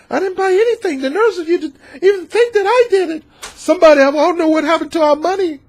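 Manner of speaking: indignant denial. Acted. Wife defending herself to husband, high indignant protest. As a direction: act insulted that he'd even ask. Wife denying blame in skit.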